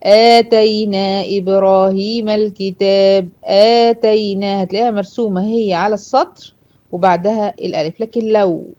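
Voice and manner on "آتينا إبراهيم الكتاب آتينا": slow, measured Quran recitation with long vowels; recited example. Shown as a demonstration. Teacher reciting a verse to show the madd, slow recitation. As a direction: recite it slowly, holding each madd. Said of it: "لكن لما أقول".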